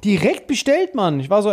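usual speaking voice

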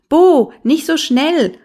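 high, loud, irritated shout after someone; annoyed call. A narrator's reading. Big brother calling after the runaway little one, annoyed. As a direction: call out crossly, pitch jumping up. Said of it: "rief Prosper ihm ärgerlich nach".

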